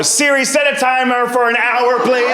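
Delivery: high, slow, pointed request to Siri; sassy mom. Retold or imitated. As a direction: drawl it out to spite her. Mom sets timer defiantly.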